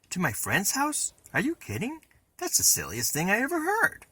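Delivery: high, incredulous scoff. A narrator's reading. Boy scoffing at his sister's question, high and incredulous. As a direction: laugh off the silly idea.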